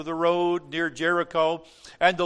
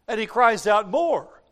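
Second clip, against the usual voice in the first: loud, high preaching cry; emphatic, lifted narration; insistent raised voice; 6 semitones higher, 8 dB louder than usual. A narrator's reading. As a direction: raise the voice to echo his cry.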